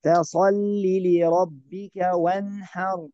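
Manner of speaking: measured recitation, no hum in "وانحر"; correct clear nun. Shown as a demonstration. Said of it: "لا نقول وانحر".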